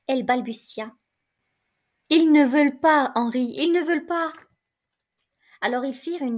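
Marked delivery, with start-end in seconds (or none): 2.1-4.3 put-on a touch of tearful whimper, spoiled child denied; lightly voiced childish sobbing. A narrator's reading. Young wife turning to her husband, sobbing like a child. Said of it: "avec une voix pleine de sanglots, une voix d'enfant".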